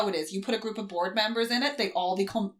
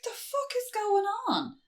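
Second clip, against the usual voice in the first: very high, slow incredulous voice; mock bewilderment; slower than usual. He returns to absolute carnage, high bewildered voice. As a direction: draw it out in high-pitched disbelief.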